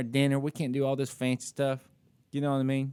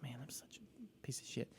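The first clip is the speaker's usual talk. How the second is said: whispered self-reproach; guilty whisper; 19 dB quieter than usual. Retold or imitated. Man confessing his guilt at getting home, whispered self-reproach. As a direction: drop into a hushed, ashamed whisper.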